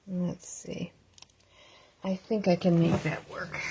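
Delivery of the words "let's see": quick whispered aside; whispering. Real, not acted. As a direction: breathe it out as a whisper. Muttering to herself while adjusting.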